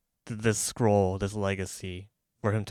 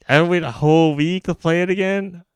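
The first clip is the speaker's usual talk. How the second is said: high, loud put-on whine; mock whine; 7 semitones higher, 10 dB louder than usual. Complaining to a friend about the weekly wait, mock whine. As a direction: whine it like a kid who can't wait.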